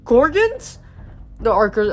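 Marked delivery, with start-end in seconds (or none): 0.0-0.8 high squeak of alarm. A narrator's reading. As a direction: squeak it in fright. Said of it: "archer's voice squeaked".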